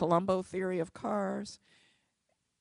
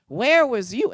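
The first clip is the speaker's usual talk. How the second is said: much louder, shouted accusation; furious shout; 5 semitones higher, 14 dB louder than usual. Retold or imitated. Tenant shouting at the lawyer, louder still. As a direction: yell it at her with full force. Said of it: "She reamed me a new one".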